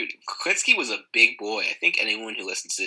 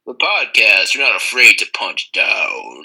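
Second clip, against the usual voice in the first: announcer tagline; 11 dB louder, slower than usual. Acted. Intro tagline, loud slow.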